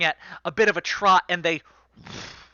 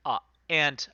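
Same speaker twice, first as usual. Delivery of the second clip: usual speaking voice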